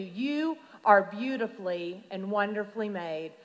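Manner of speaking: ordinary delivery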